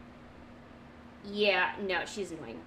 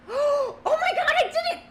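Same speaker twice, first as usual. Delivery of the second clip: real triumph; 7 dB louder than usual. Real, not acted. Finally getting the drawing right, overjoyed shriek. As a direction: shriek in disbelief that you nailed it.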